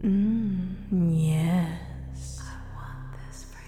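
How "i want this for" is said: hushed hypnotic whisper. Acted. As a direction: whisper it close to the ear.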